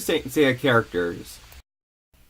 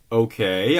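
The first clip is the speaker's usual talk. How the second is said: usual speaking voice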